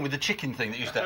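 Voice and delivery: ordinary talk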